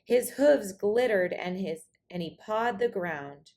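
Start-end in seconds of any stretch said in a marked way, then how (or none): none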